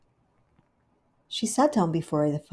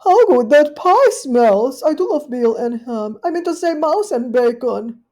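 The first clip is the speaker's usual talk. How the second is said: Duchess's voice; 6 semitones higher, 10 dB louder than usual. Acted. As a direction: savour the smell greedily. Duchess sniffing pie.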